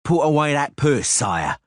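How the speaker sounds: guard threatens a bribing traveller, menacing. Acted. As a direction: warn him off with force. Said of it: "said menacingly".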